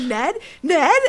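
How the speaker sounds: calling out. Retold or imitated. Acts out calling for missing brother.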